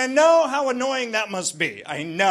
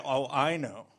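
lower, softer mock know-it-all voice; a smug local who thinks he's guessed it; lightly voiced smug Kiwi; imitating locals; 7 semitones lower, 5 dB quieter than usual. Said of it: "And then they say".

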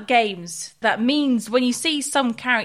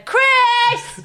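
loud, high-pitched yell of a name; retold call for help; 12 semitones higher, 5 dB louder than usual. Frustrated player calling partner for help, mock yell. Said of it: "I sometimes have to just say".

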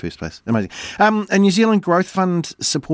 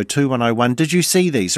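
ordinary delivery

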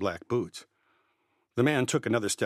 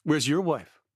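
raised and blunt, higher and louder; a boy speaking up louder than he means; louder, direct; 5 semitones higher, 4 dB louder than usual. A narrator's reading. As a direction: confront the man head-on. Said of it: "louder and more direct than I wanted".

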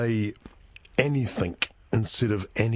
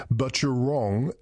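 ordinary talk

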